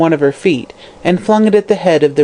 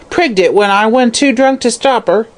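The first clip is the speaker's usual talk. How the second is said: indignant crone; 6 semitones higher than usual. Acted. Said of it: "she shrieked indignantly".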